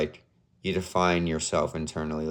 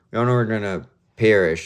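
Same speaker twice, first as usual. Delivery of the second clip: louder, higher, doom-laden; dramatic; 4 semitones higher, 6 dB louder than usual. Real, not acted. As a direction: lean into it like a grim prophecy. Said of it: "That makes it sound even worse".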